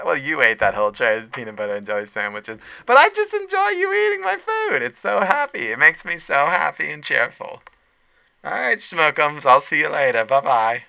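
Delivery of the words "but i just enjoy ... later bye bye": very high, louder doting lunch lady. Pretend. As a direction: coo at him like an adoring cafeteria lady. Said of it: "[name]'s Lunch Lady live".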